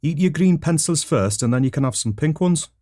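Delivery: high-pitched Geordie mum; Geordie accent. Acted. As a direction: scold the children in high Geordie. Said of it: "Missus Pencil Sharpener had a Geordie accent".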